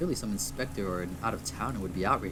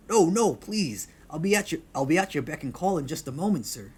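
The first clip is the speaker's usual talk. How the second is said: loud, raised pleading call from afar; male bartender calling; 7 semitones higher, 8 dB louder than usual. Acted. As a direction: call out eagerly to keep a customer. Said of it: "A male voice, deep".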